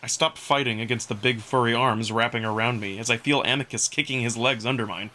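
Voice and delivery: usual speaking voice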